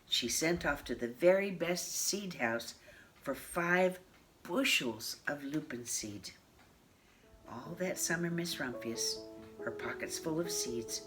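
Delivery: usual speaking voice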